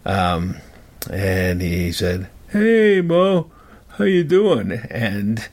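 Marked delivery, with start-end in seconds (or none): 2.5-4.7 high, loud friendly hello, a little overdone; imitating the man's too-cheerful hello; mock cheery greeting. The hippie boy retelling how the man hailed him, mock cheery greeting.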